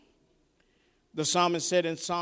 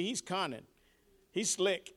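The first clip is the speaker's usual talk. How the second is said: ordinary talk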